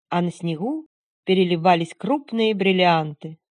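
ordinary delivery, nothing marked

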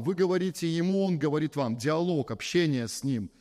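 ordinary delivery

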